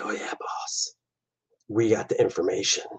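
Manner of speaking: whispered reply, voice dropped to near nothing; henchman whisper. Acted. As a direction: answer the boss in a hushed whisper. Henchman answering the boss.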